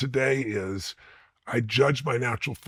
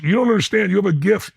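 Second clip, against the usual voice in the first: insistent teacher; 7 semitones higher, 7 dB louder than usual. Retold or imitated.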